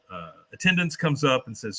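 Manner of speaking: normal talking voice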